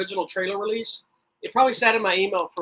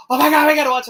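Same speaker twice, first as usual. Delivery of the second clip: much higher and louder, eager retelling of his wife; excited wife; 7 semitones higher, 10 dB louder, faster than usual. Retold or imitated. Husband retelling wife seeing the DVD arrive, loud eager excitement. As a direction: gush like you can't wait another minute. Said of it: "She goes".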